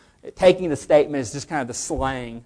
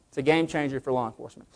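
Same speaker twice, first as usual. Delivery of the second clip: softer, fast repeat of game changer; quiet aside; 3 semitones lower, 8 dB quieter, faster than usual. Shown as a demonstration.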